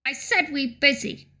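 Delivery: lightly voiced impatient goblin. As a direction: repeat it as an irritated, busy goblin. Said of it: "that's my goblin voice".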